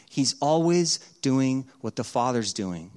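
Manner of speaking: ordinary talk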